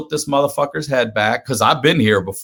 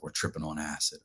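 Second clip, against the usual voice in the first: low, quiet confession; hushed; 9 semitones lower, 17 dB quieter than usual. Retold or imitated. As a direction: murmur it secretly. Cousin confides she is tripping.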